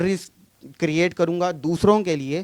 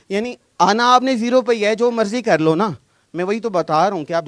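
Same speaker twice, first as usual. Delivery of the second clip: raised, higher and louder, pressing the point; excited insisting; 5 semitones higher, 8 dB louder than usual. Real, not acted. Arguing with the group member, pitched up and louder. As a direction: push back hard on the group, voice up. Heated group debate about prayer.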